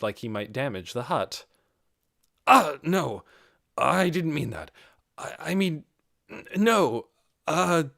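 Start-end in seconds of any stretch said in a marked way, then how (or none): 2.5-7.8 louder, higher, stammering denial; flustered panic. A narrator's reading. Embarrassed lizardman denies his accidental mating call, loud and flustered. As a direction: scramble to take it back. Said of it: "Zaryusu's surprise and panic".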